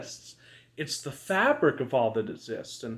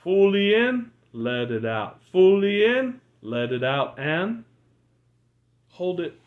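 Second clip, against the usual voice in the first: guided breathing coach. Acted. Leads breathing exercise.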